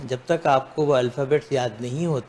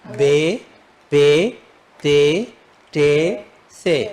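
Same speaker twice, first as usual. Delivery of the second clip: slow recital; 3 dB louder, much slower than usual. Shown as a demonstration. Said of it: "تو کیا پڑھ لیا آپ؟".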